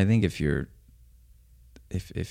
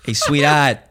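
mock mafia guy, louder and higher, drawling sweetheart; mafia accent; 6 semitones higher, 5 dB louder, slower than usual. To a date, hamming up a mafia accent. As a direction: lay on the wiseguy mafia charm. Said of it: "You went into the whole, like, g-, mafia accent?".

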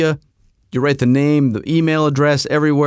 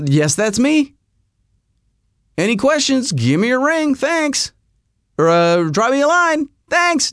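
mock cheery, pitched much higher; breezy; 10 semitones higher than usual. Reading his own chirpy reply to caller, hammed-up cheer. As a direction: play yourself as an overly chipper salesman.

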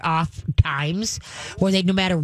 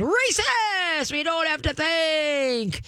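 very high, strained, then slow; shrill mock outrage; mock hysterical shout; 10 semitones higher, much slower than usual. Mocking people who shout without thinking. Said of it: "It's like saying".

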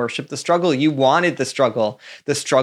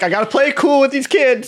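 mock cool parent; 11 semitones higher, 5 dB louder than usual. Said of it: "Oh, you're like".

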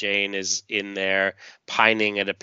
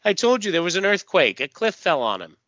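defensive; 8 semitones higher, 3 dB louder than usual. Acted. Lady Quark's defensive answer.

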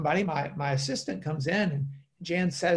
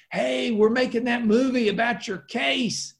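higher-pitched imitation of an eager caller; eager producer on phone; 9 semitones higher, 3 dB louder than usual. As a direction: lightly act out a keen Hollywood producer. Voices excited movie producer on phone.

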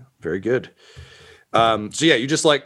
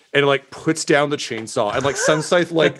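ordinary talk

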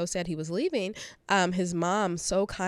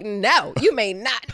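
firm refusal; 3 semitones higher, 7 dB louder, much slower than usual. Retold or imitated. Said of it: "looked at him sideways and was like".

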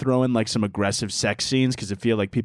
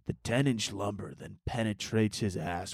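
mock sultry narrator; 8 dB quieter than usual. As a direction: draw it out like a cheesy romance narrator.

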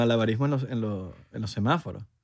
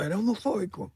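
high, put-on voice mocking an accusing activist; mock accuser; 7 semitones higher, 4 dB quieter than usual. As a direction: sneer out the accusation like a caricature.

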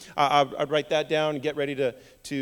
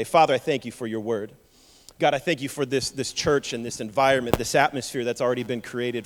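prayer; 4 semitones lower than usual. Acted. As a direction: pray it earnestly and low. Leads an opening prayer.

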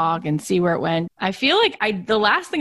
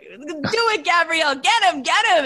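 higher, scheming little gremlin voice; gremlin toddler; 9 semitones higher than usual. Retold or imitated. Mom imitating her three-year-old rallying her sister, higher gremlin voice. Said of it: "I think it's like a gremlin".

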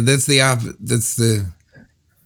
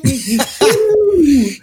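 high laugh; 12 semitones higher than usual. Real, not acted. S1 laughs high after the song.

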